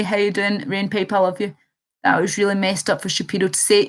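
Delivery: usual speaking voice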